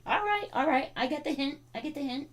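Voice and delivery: high, soft pet talk; giving in. Real, not acted. Owner gets the dog's hint, giving in softly. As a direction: concede softly to the dog in a high voice. Gives in to dog.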